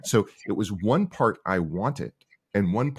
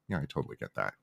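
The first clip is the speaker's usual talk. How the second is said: disarming agreement; 4 semitones lower, 9 dB quieter, faster than usual. Retold or imitated. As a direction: drop much softer and lower, easy and understanding. Founder disarming an upset user.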